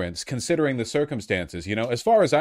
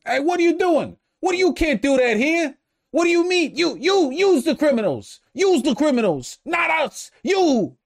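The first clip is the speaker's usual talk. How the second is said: imitating him, much higher and louder, earnestly outraged; earnest indignant protester; 5 dB louder than usual. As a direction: echo the clip guy's indignant yelling in a high voice. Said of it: "he speaks with the earnest, unpretentious sincerity".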